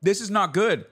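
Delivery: ordinary delivery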